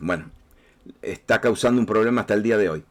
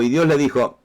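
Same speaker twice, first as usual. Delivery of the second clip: ordinary talk, nothing marked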